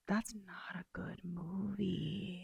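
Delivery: hushed confession; whispering. Real, not acted. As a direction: whisper it like a guilty secret. Admitting the movie is bad.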